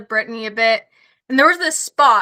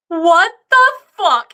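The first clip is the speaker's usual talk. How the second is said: drawn-out, loud shocked outburst; stunned disbelief; 6 semitones higher, 6 dB louder, slower than usual. Retold or imitated. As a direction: let the disbelief blow up slowly and loudly. Shocked to find a moose.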